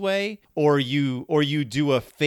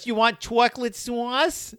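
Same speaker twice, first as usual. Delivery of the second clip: higher-pitched mock New Jersey, extra syllables in "chocolate sauce"; exaggerated New Jersey accent; 8 semitones higher than usual. Quoting Dawn offering chocolate sauce, hammed-up New Jersey accent. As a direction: stretch "chocolate sauce" into twisted New Jersey vowels, "chwockluht swoss". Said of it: "five extra syllables in chocolate sauce".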